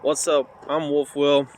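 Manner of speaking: usual speaking voice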